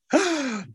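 sharp high gasp of joy; delighted gasp. Acted. Creator spotting the bear in the sky, gasping. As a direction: gasp as the first star figure appears.

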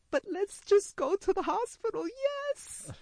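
loud, very high comic wail; hammed-up frantic cry; desperate outburst. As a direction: burst out pleading, climbing to a squeal. Retold desperate outburst, comic climax.

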